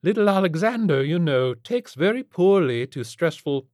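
loud, high, haughty fussing; fussy rich matron. A narrator's reading. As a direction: fuss over a pet like a grand lady. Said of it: "she held up her emu".

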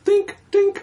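high, squeaky dink dink; imitated blink sound. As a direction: squeak out a tiny ding.

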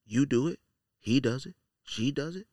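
normal talking voice

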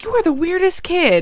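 high, teasing "you weirdo" tone; amused disbelief. Retold or imitated. Sibling retelling what she thought of her brother, high amused disbelief. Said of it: "I'm like".

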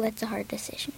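muttered whisper to himself. Real, not acted. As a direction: whisper it, unsure, no voice. Boy hesitating over answer.

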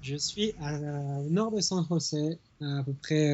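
distant, quiet phone line sound; over the phone. Real, not acted. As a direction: voice coming through a phone. Phone call just connected.

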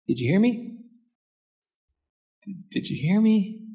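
soft, questioning little voice; tentative asking. Acted. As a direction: check timidly whether anyone is listening. Timidly checks that God heard.

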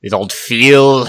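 loud, slow mock Austrian accent; Schwarzenegger impression. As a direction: bark it like a bad Schwarzenegger. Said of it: "I do a terrible Schwarzenegger".

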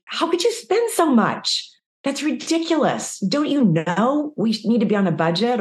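scolding spouse. Retold or imitated. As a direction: scold over the spending.

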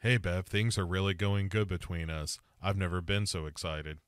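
unexcited monotone, low and subdued; deadpan. Acted. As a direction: claim excitement with no excitement at all. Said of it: "Can't you tell how excited I am".

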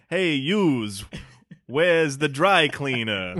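hammed-up New York accent, drawn out. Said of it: "trying to do a New York accent".

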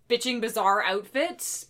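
ordinary talk, nothing marked

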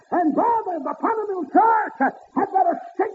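high ringing preacher's cry; preaching at full force. Acted. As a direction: lift it up and ring it out to the congregation. Preacher whooping.

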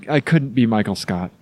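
ordinary talk

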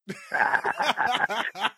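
high wheezy laugh. Real, not acted. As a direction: laugh at your own joke. Laughs at own joke.

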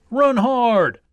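loud, high rallying cry; cheering shout. A narrator's reading. Supporters of the tortoise urging him on, shouting. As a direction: cheer your runner on at full voice.

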